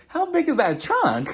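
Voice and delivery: high-pitched incredulous question breaking into laughter; amused disbelief. Acted. Host reacting to everything pulled from the trunk, laughing disbelief. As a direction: blurt it out, astonished and tickled.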